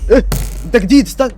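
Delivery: high, loud shocked question; jumpy surprised yell; startled shout. Real, not acted. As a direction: blurt it out in fright. Sudden figure in horror game.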